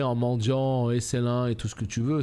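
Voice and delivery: ordinary delivery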